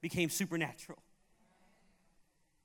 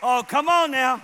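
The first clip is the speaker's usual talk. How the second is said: loud, raised preacher's exclamation; rousing call-out; 4 semitones higher, 12 dB louder than usual. Real, not acted. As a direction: shout to stir the congregation. Preacher rousing congregation after punchline.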